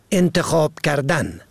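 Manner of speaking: usual speaking voice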